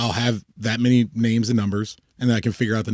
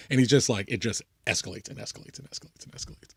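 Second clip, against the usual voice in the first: normal talking voice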